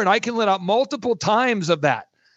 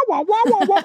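high, muffled trombone-like wah wah, much higher; Charlie Brown adult wah-wah; 13 semitones higher than usual. Retold or imitated. Mocking the banker's talk as cartoon adult wah-wahs. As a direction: mimic the droning grown-up from Charlie Brown. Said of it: "that character from Charlie Brown where it's wah".

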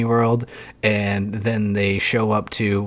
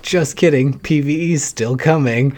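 playful announcer; 5 semitones higher, 4 dB louder than usual. Retold or imitated. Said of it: "if they were like".

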